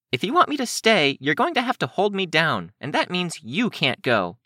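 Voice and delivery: put-on high, insistent girl voice; high-voiced defiance; defiant Kenzie. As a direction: dig in stubbornly, pitched well up.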